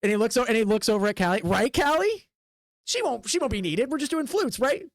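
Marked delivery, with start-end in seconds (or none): 1.4-4.8 needling showman. Acted. Showman needling his assistant.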